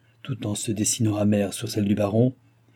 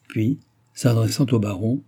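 plain reading voice

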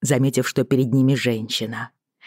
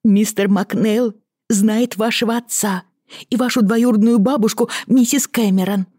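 cartoon princess; 8 semitones higher than usual. A narrator's reading. Lizzie continues excited.

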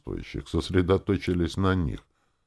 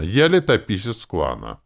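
ordinary delivery, nothing marked

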